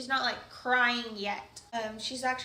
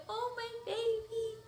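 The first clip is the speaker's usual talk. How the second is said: tearful tender; 8 semitones higher, slower than usual. Retold or imitated. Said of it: "I'm, like, crying".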